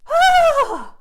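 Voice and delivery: very high loud shriek; startled scream. Acted. Little goat screaming at the troll. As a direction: shriek in fright.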